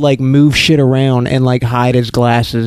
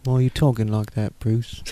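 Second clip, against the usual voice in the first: quieter, lower, gruff butler voice; low gruff Alfred voice; 3 semitones lower, 9 dB quieter than usual. Retold or imitated. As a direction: mutter it like an old butler. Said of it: "Be more what it's like".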